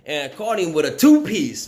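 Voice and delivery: ordinary delivery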